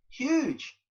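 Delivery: "huge" lifted high and stretched out; emphatic huge. Real, not acted. To the interviewer about the money, "huge" stressed high. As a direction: land hard on "huge".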